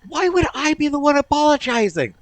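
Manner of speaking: high, loud incredulous protest; outraged high-pitched rant; indignant outburst. Real, not acted. Mock outrage at game apology.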